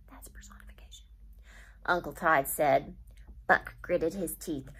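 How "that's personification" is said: a breathy whisper, the teacher stepping out of the story; whispered aside. Shown as a demonstration. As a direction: lean in and whisper the term.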